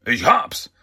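mildly excited exclamation; a touch of villainous eureka; sudden cry. A narrator's reading. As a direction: call it out as a sudden idea strikes. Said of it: "dann rief er plötzlich".

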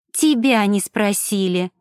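ordinary delivery, nothing marked

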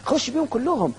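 lightly voiced tempting devil. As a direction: coax him to go all in like the devil. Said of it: "هنا الشيطان شاطر ... يقول لك".

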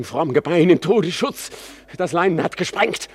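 agitated pleading. Acted. Hamlet urgently questioning his father's ghost. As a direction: press the ghost for answers, rising in pitch.

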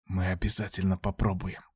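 soft whispered reply; whispering. A narrator's reading. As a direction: whisper it gently. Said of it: "также шёпотом ответила Элоди".

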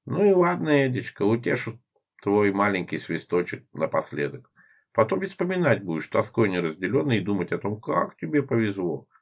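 high, coquettish drawl; flirtatious drawl. Acted. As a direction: stretch the words flirtatiously. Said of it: "кокетливо растягивая слова".

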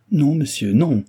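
spirited read-aloud exclamation; lively protest. A narrator's reading. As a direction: object quickly, with some force. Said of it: "s'écria-t-elle vivement".

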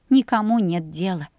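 ordinary delivery, nothing marked

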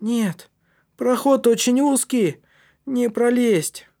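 put-on high, slow, effortful boy's voice; straining. A narrator's reading. Матиас reports the passage is too narrow, straining. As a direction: squeeze the words out slowly.

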